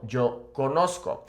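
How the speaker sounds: "conozco" with an s then a hard k, co-NOS-ko; sc-o ending. Shown as a demonstration. Teacher shows the irregular yo form, "conozco" said co-NOS-ko. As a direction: sound the k in "conozco". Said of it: "We have Z C O".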